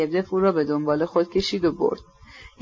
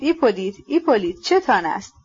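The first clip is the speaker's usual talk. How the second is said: plain reading voice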